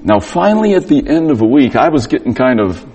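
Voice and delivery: normal talking voice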